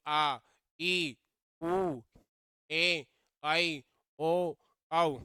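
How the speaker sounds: each vowel held long and loud, one by one. Shown as a demonstration. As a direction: draw out आ, ई, उ, ए, आई, ओ, औ to double length. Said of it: "दुगना समय लगता है".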